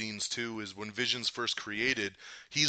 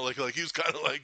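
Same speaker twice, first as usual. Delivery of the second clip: normal talking voice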